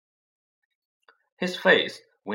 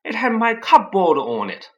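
loud, higher angry retort; 9 semitones higher, 9 dB louder than usual. A narrator's reading. Harry angrily argues with Uncle Vernon. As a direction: press the point in anger. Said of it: "said Harry angrily".

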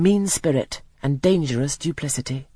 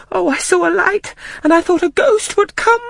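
put-on frightened child; 6 dB louder than usual. A narrator's reading. Frightened child explains to nurse.

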